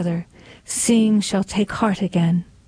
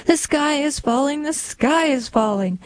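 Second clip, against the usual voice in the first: higher-pitched alarmed hen call; panicky Chicken Little; 6 semitones higher than usual. Acted. Chicken Little calling to neighbor Henny Penny, a higher panicky cry.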